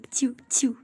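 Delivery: soft unvoiced tiu tiu like a tiny gun; whispered gun noise. Gamer mimicking gunfire sounds under his breath, whispered. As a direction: breathe out mock shots tiu tiu.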